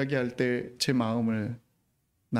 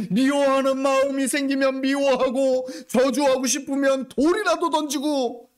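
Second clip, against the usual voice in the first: high, loud, pressing sermon climax; preaching at full force; 12 semitones higher, 6 dB louder than usual. Acted. Pastor challenging his congregation, full-force preaching. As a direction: hammer the challenge home from the pulpit.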